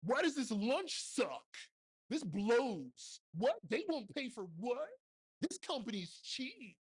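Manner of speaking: high, whining gripe about the crew perks; mock a spoiled crew member's moaning; whiny complainer.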